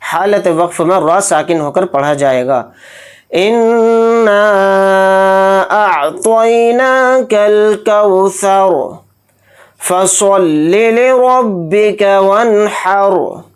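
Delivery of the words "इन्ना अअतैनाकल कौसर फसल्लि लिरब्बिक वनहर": slow, drawn-out chanted tilawat; Quranic recitation. Acted. Teacher opening the lesson, slow chanted recitation. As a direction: intone each word slowly and reverently.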